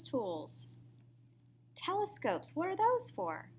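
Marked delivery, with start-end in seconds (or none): none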